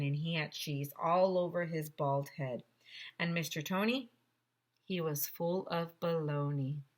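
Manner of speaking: ordinary delivery, nothing marked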